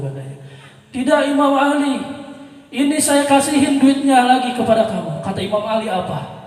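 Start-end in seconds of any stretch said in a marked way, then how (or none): 0.9-5.2 insistent plea. Retold or imitated. Man insists on returning money.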